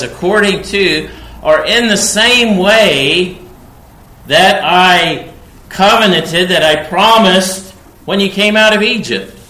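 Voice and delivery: loud, high, stretched words; fervent preaching. Acted. Preacher recalling the Exodus promise to the people, fervent. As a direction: preach the covenant with fire.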